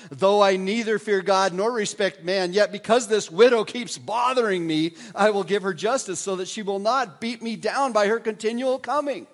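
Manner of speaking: a worn-down judge giving in, loudly; exasperated judge. Retold or imitated. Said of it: "he said to himself".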